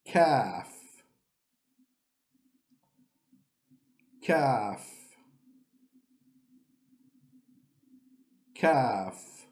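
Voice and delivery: Kaf said round from the front of the mouth; a clean rounded Kaf; Arabic letter Kaf. Shown as a demonstration. Teacher models Kaf for learners. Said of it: "it is pronounced round".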